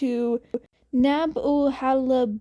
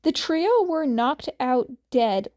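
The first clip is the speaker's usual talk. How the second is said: ordinary delivery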